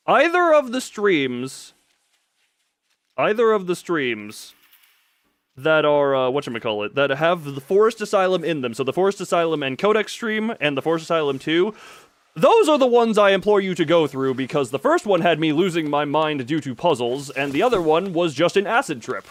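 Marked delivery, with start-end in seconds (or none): none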